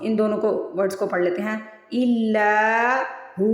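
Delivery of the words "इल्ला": इल्ला with ला held long and slow; stretched recitation. Shown as a demonstration. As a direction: draw out ला in इल्ला. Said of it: "पहले simple पढ़ लें". (इल्ला को खींचकर)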